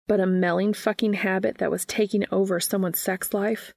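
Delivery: plain reading voice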